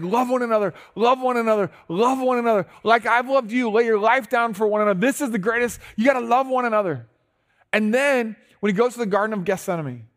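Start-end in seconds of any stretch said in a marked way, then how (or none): 0.0-7.1 urgent repetition. Retold or imitated. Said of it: "He's like".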